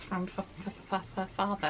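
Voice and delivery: stuttering on the f; repeating the f sound. Real, not acted. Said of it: "I don't know why I'm stuttering today".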